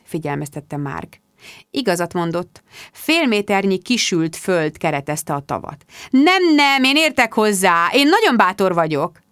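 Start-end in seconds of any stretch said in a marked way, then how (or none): 6.1-9.2 high, louder protest, then settling; boastful insistence. A narrator's reading. Rebecca dismissing Mark's warning about shallow water, boastful. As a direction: brush the warning aside grandly.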